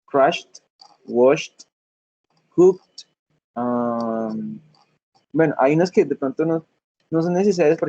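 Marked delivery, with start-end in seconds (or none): 0.0-3.1 -ed said as a T in "crashed", "washed", "cooked"; "crashed", "washed", "cooked" as one-syllable words ending in T; final T sound. Shown as a demonstration. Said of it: "la T al final".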